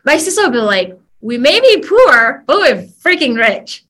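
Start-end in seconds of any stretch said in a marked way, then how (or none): 1.2-3.8 proud comeback. Retold or imitated. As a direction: say it like a proud punchline. Sister's proud comeback retold.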